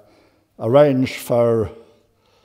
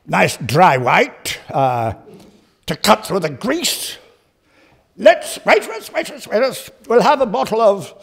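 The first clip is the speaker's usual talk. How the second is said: posh food critic; 8 semitones higher, 5 dB louder than usual. Retold or imitated. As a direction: savour each word like a snob ordering wine. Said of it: "And Lawton said".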